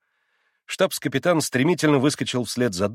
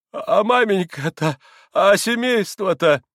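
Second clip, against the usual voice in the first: stammered, high, loud question; anxious high voice, halting start; distraught pleading; 9 semitones higher, 5 dB louder, slower than usual. A narrator's reading. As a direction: ask helplessly about your family. Father anxiously asks about family.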